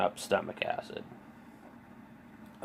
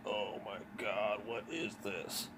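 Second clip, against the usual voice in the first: much softer, slow, moaned words; pained moan; 11 dB quieter than usual. Retold or imitated. Curled on the floor, moaning as wife comes out. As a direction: moan it out, barely audible. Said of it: "she can hear me moaning out there".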